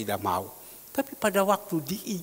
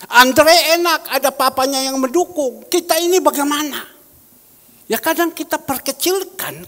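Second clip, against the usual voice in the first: full-force sermon reading; preaching at full force; 8 semitones higher, 9 dB louder than usual. Acted. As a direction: boom the verse out to the congregation. Preacher imitates his younger self.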